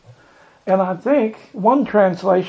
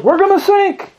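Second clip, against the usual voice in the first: high-pitched cry of alarm; acted high wail of dread; panicked cry; 11 semitones higher than usual. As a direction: call it out in mock panic. Acting the disciples' panic.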